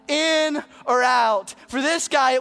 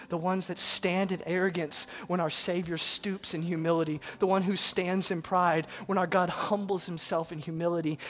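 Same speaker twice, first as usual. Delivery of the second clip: usual speaking voice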